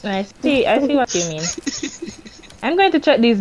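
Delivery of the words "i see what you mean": ordinary talk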